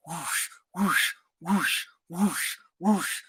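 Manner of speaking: soft low whoosh noises like brushing the carpet; whooshing sweep. Retold or imitated. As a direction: breathe out whooshes as you wipe away footprints. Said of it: "I'd be like".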